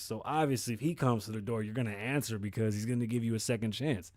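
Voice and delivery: ordinary delivery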